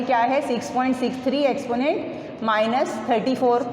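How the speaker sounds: ordinary talk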